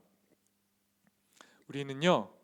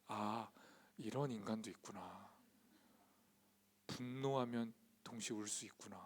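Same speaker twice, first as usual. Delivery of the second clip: low, soft musing to himself; retold inner monologue; quiet realisation; 5 semitones lower, 9 dB quieter than usual. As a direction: voice a private thought, hushed and low.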